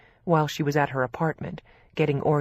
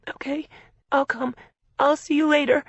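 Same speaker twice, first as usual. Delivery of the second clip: nervous; 10 semitones higher, 4 dB louder than usual. A narrator's reading. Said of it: "sounding nervous".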